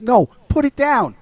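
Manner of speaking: mock-stern refusal; firm order. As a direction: cut him off with an order. Retelling Wyatt's sharp order.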